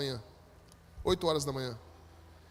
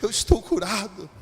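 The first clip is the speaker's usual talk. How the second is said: slow, emphatic joyful declaration; jubilant healed man; 5 dB louder, slower than usual. Retold or imitated. Healed man jumps up to testify, jubilant. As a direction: retell a healed man's rising cry of triumph. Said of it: "começou a pular e dizer".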